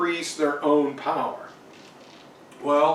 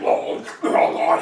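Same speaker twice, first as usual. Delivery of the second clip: wordless beastly gobbling noises; slobbering beast. Acted. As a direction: imitate a beast slobbering over dinner. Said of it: "the Beast when he's having dinner".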